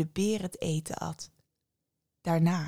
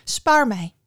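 loud, higher-pitched cry for mercy; frightened plea; 4 semitones higher, 9 dB louder than usual. A narrator's reading. Young woman begging the bear to spare her, frightened plea. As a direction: plead for your life, loudly. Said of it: "wist de jonge vrouw uit te brengen".

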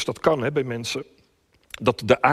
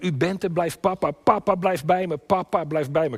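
put-on child's plea; 5 semitones higher, 3 dB louder than usual. Voices a child pleading with father.

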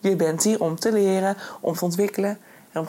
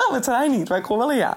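laughing; 4 semitones higher than usual. Real, not acted. Laughing about train.

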